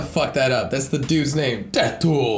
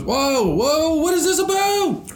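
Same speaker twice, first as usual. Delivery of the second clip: amazed; slower than usual. Real, not acted. Excited surprise at dinosaur screen.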